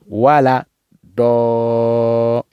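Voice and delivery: "voilà. Dolin" with a long held "Do"; stretched recitation. Shown as a demonstration. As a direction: sustain "Dolin" on a level tone. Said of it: "en tirant plus fort".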